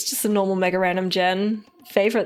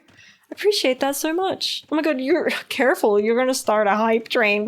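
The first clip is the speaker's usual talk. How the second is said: ordinary talk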